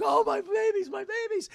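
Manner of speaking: high, loud mock wail; anguished father. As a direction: wail over the children as the dad. Father finds wife and child.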